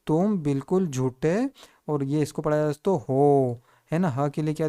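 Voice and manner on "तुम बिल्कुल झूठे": slow, word-by-word reading. Shown as a demonstration. Teacher reading the built-up phrase to students, slow read-out. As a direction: read each word out slowly for learners. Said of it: "तो क्या हो जाएगा".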